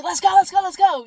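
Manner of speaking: rally everyone like a hyped coach; high, loud, bouncy "let's go" call; eager cheer. Retold or imitated. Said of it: "And they're like".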